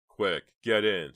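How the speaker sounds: loud, drawn-out order; urgent shout. A narrator's reading. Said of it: "shouted Chuck".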